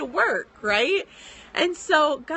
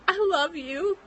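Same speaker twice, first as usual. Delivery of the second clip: tearful; 6 semitones higher than usual. Real, not acted. Writer moved by a free book, quoting God tearfully. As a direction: voice lifting through tears. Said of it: "cue tears".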